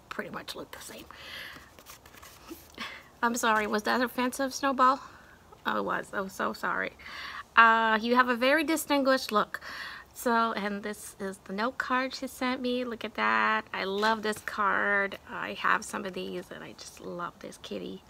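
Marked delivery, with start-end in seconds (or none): 0.1-1.0 soft unvoiced aside; whispered joke. Real, not acted. Joking aside about cats. 15.3-18.0 soft unvoiced aside; hushed admission; whisper. Real, not acted.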